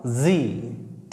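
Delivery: जी said softly, low and drawn out; soft pronunciation. Shown as a demonstration. Teacher shows the English letter, soft pronunciation of जी. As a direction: say जी gently, easing into the consonant. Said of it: "softly बोलना पड़ेगा".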